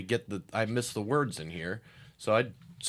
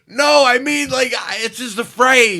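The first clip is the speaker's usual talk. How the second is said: much higher, louder, panicky explanation; nervous over-explaining; frantic backtrack; 12 semitones higher, 10 dB louder than usual. Retold or imitated. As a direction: scramble to take it back. Frantic backtracking apology retold.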